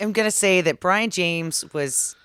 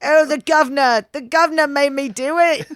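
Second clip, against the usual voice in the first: high, loud mock Cockney whine; fake British accent; 8 semitones higher, 6 dB louder than usual. Woman keeps up her silly Cockney for laughing friends. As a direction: whine it like a cartoon Cockney crook. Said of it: "exact same British accent".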